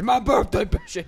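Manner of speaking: shouting. Retold or imitated. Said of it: "shirt that says".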